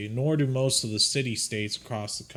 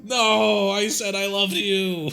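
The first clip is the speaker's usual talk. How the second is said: higher, louder insisting confession; insistent repeat; 7 semitones higher, 4 dB louder, slower than usual. Acted. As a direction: insist she heard you wrong. Insisting to the NPC.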